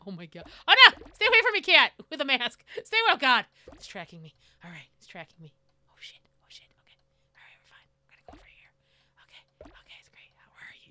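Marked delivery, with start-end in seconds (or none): 0.7-3.4 high, loud panicked yell. Real, not acted. Player fleeing the masked cat, yelling in panic. 5.9-10.9 hushed, breathy whisper; tense whisper. Real, not acted. Player hiding from the cat, whispering tensely.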